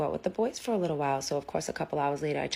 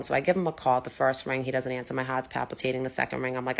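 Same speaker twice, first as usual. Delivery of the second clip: ordinary talk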